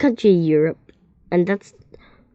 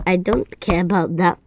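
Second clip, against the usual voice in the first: usual speaking voice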